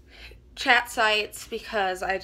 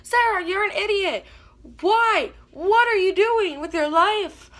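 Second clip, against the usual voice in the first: high, loud scolding commenter; loud mock scolding from imagined commenters; 9 semitones higher, 6 dB louder than usual. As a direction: yell it like an angry internet troll. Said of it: "this is where everybody comments".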